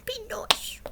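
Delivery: very high, pleading mock Cockney; silly little Cockney voice, way up high; bashful Cockney impression. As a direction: plead shyly in a badly faked Cockney. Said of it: "Cockney accent without something, sounding stupid".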